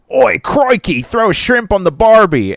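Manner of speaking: loud, high, hammed-up Australian accent; a deliberately terrible Aussie impression; bad Australian accent. Comedian pranking a tourist with a loud, bad Australian accent. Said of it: "Let's do horrible, like, European accents".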